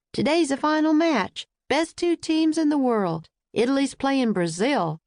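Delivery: a friendly Georgia guard hyping the final; Southern accent. A narrator's reading. Benny tells Jack who plays the final, eager Southern accent. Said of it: "He spoke with a Southern accent".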